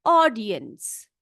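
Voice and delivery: ऑडियंस said slowly, syllable by syllable, aw-di-yans; drawn out ऑडियंस, each sound clear for the class; slow careful pronunciation. Shown as a demonstration. Teacher to pupils, sounding out ऑडियंस slowly. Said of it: "देखो कैसे पढ़ रही हूं मैं इसको".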